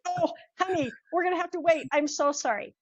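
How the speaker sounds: lightly voiced sweet apology to granddaughter; apologetic grandma. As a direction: soothe a disappointed little girl. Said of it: "I'm like".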